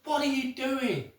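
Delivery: high, loud, appalled cry; husband shocked at his wife laughing at the funeral, appalled; shocked outburst. Acted. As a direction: blurt it out in horror.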